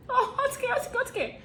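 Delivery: high, fretful repeated cry; fretful drama heroine; K-drama panic. Retold or imitated. Mimicking K-drama dilemma cry.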